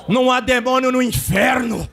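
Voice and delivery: brado profético, subindo ao agudo; pregação a plena força. Real, not acted. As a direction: profetize com fervor, quase cantado. (pregação pentecostal inflamada)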